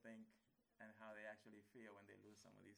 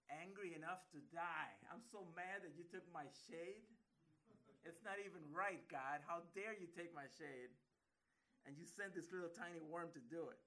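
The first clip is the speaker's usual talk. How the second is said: loud, indignant outburst at God; angry Jonah; 4 semitones higher, 8 dB louder than usual. Acted. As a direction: shout the grievance, slowing on how dare you. Jonah voiced complaining to God.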